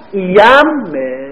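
ایمه stretched out, held on doubled م; slow modelled word. Shown as a demonstration. Teacher models the final form slowly. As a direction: draw out ایمه for the class.

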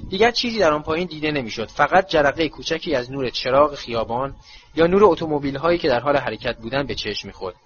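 ordinary delivery

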